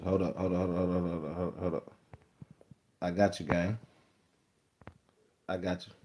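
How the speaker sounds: low, quiet, repetitive murmur; absent-minded and hushed; distracted muttering. Real, not acted. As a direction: say it under your breath while busy. Preoccupied while handling the chat.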